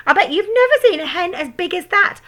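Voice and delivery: very high, loud crone call; shouting grandma. A narrator's reading. Grandma shouting from the roof at George's mother. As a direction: crow it at her. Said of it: "Grandma shouted".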